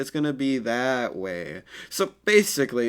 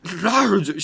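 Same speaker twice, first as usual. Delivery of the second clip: high, louder angry shout of his name; angry yell; 7 semitones higher, 6 dB louder, slower than usual. Acted. Sakura getting mad at Naruto, high angry yell. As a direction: snap his name angrily. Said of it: "she gets kinda mad".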